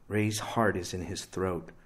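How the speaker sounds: ordinary delivery, nothing marked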